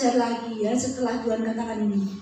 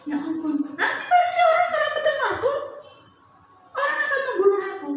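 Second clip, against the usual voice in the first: high, fast, laughing complaint; mock whiny Cain; 14 semitones higher, much faster than usual. Cain complaining to God he will be killed, mock whine. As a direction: whine it out like a sulking child. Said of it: "dia bilang".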